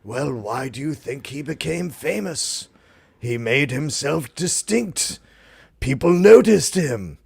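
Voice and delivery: slow, lightly voiced old professor; a hint of a wise old professor explaining; old European professor. Student retelling what the old European professor told him, slowly. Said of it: "he was an old European professor".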